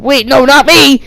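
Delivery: high, loud, hammed-up panic; acted alarm, voice jumping up; panicked plea. A narrator's reading. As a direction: cry out to be spared. Villain pleads before being shot.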